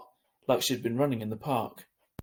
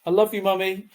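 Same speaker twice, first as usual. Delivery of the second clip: high, louder farewell; tearful call; 8 semitones higher, 7 dB louder than usual. A narrator's reading. Sister calling farewell to Mum at the taxi, high and louder. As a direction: call it after her.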